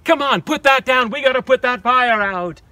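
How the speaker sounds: exasperated fire chief. Acted. Fire chief scolding the dragon, high and loud. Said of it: "the fire chief cried".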